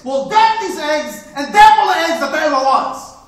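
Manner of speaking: loud, high, desperate husband; husband crying out to his wife; anguished stage cry. Acted. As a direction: burst out with it. Onstage husband's outburst.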